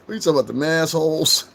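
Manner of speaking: high-pitched joking quote; mock questioning. Joking with friends about people in Boston, high mock question. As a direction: toss it out high as a playful jibe. Acting out a joking nickname remark.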